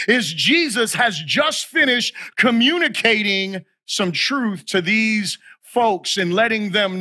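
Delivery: emphatic, measured pulpit delivery; preaching. Real, not acted. Preacher urging church to get the gospel correct, emphatic preaching. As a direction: preach it with weight, phrase by phrase.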